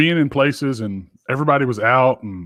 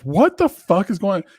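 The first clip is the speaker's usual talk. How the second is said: startled; 6 semitones higher than usual. Retold or imitated. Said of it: "I'm like".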